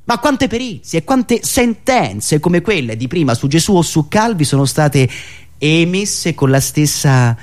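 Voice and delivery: ordinary talk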